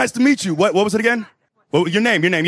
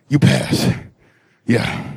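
spent; 12 semitones lower than usual. Acted. Mock teacher winding down.

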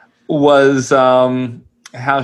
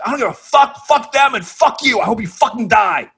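pitched-up, put-on voice of a teen hurling abuse; furious teen voice; 7 semitones higher than usual. Teen cursing out his minder in a mock furious voice. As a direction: snarl it like a spiteful kid telling off his minder. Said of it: "He's like".

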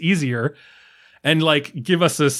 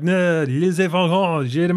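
mock French. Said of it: "that was offensive to French people".

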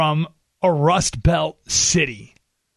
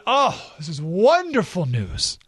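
mock delight; 4 semitones higher, slower than usual. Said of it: "And I said".

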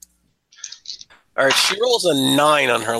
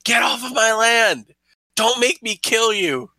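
yelling; 8 semitones higher than usual. Acted. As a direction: bellow at the trespassers to get off her land. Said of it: "and just yells".

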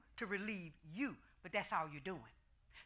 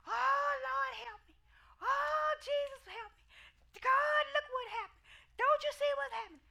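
much higher, wailing cries of help; mock screaming woman; 5 dB louder than usual. Preacher imitates the woman screaming on her porch, high wailing cries. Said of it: "and you was just screaming".

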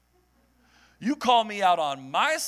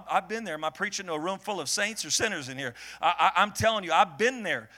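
ordinary delivery

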